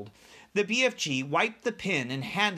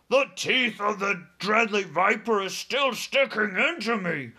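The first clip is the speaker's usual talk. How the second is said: high, loud cry of a bitten giant; pained yell; 5 semitones higher, 3 dB louder than usual. A narrator's reading. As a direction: yell out in alarm, higher and louder. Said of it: "he yelled".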